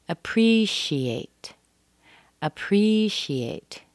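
slow "Appreciate", PRE lifted, C said as SH; SH sound in appreciate. Shown as a demonstration. Teacher demonstrates the S H, slow clear SH. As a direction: spell out "Appreciate" by ear as uh-PREE-shee-ate. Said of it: "It's making that S H".

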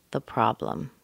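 ordinary talk, nothing marked

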